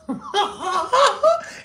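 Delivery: loud high laugh. Real, not acted. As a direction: burst of high squealing laughter. Laughs at photo.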